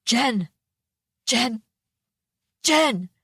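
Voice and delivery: frantic calls, rising to a shout; shouting a name. A narrator's reading. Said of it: "Dad shouted her name".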